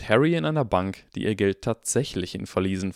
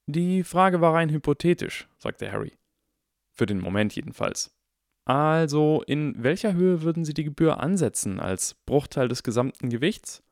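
lightly voiced higher boy, reasoning; a hint of a precise, curious child; inquisitive boy; 7 semitones higher than usual. A narrator's reading. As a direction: probe politely as young Harry.

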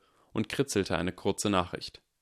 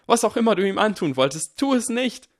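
high, loud scream; 14 semitones higher, 7 dB louder than usual. A narrator's reading. Hermine screams at Harry to stop. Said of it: "schrie Hermine".

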